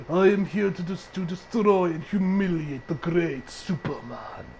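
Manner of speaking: put-on slow, low Russian villain accent; a hint of a Drago-style Russian accent. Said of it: "talk with a Russian accent".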